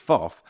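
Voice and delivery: FOFF squashed into one quick syllable; child's slurred swearing. Retold or imitated. Dad quoting his daughter's tic, run together. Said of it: "She's kinda got so fast".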